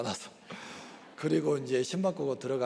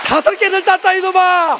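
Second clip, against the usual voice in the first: shouting much higher and louder at his son; retold angry yell; 16 dB louder than usual. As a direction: bellow it like a furious dad. Yelling at son in quarrel. (아들에게 크게 호통치는 목소리)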